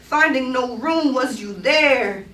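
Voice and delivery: rhythmic, insistent poem recitation; spoken-word poetry. Acted. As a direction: perform it like live spoken word, pitched above chat.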